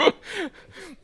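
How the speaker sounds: loud, very high laugh; amused laugh. Real, not acted. Lecturer recalling friend's sore exit, laughing loudly. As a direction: burst out laughing, high-pitched.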